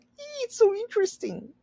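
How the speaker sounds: squeaky high excited voice; mock delighted kid. Teacher imitating Charles delighting in his tiny brother, squeaky high. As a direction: squeal it like a thrilled child. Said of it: "is like".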